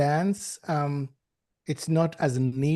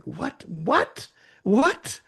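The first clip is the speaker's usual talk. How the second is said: mounting disbelief; 3 semitones higher, much slower than usual. Acted. Acting surprise at odd ideas.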